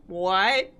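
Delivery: baffled laughing what; mock-puzzled recruiter, half laughing. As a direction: blurt it out through a laugh. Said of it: "The lady from recruitment was like".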